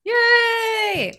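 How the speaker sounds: loud high yay; gleeful shout; cheering shout. Real, not acted. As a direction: cheer for the player. GM cheers the roll.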